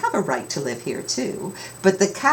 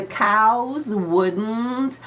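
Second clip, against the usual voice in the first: slow stubborn refrain; 6 semitones higher, much slower than usual. A narrator's reading.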